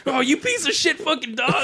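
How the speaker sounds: hammed-up furious outburst; mock angry yell. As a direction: pick a fight with the dog. Kid scuffling with the dog.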